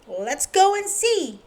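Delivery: Mister Strong offers the farmer help, eager; helpful strongman. Acted. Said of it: "said Mister Strong".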